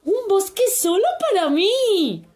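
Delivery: voz alta y agudísima de ilusión; encantado. A narrator's reading. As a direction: como un niño que descubre un regalo. Max encantado con su bosque. (niño encantado, muy agudo)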